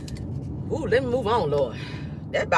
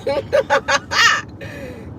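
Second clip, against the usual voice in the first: high laughing fit; real laugh; 5 semitones higher than usual. Real, not acted. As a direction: crack up at your own joke. Laughs at own joke.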